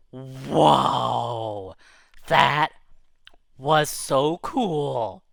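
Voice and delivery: slow, drawn-out amazement; awed. Retold or imitated. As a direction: stretch it out in low awe. Reacts in awe to stunt.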